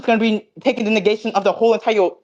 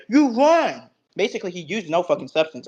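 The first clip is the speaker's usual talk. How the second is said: ordinary talk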